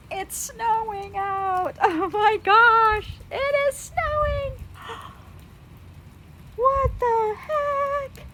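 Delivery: high, drawn-out amazement; slow, high-pitched gasping wonder; amazed disbelief. Real, not acted. Showing viewers the snow falling, amazed.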